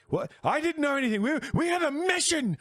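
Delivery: very high, loud and frantic; panicked high shout. Acted. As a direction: scream it in panicked denial. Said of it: "and he's like".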